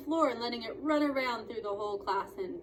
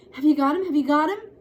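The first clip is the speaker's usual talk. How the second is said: eager excitement; 7 semitones higher, 13 dB louder, faster than usual. Acted. Patrick eager at gate.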